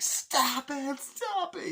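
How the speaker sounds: very high, loud shrieked plea; mock panicked scream. The beaten man begging Nicolas Cage's character, screaming. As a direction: shriek it like you're terrified. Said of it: "and he's like".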